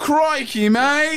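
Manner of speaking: mock Australian; Australian accent. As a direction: exclaim like a cartoon Australian.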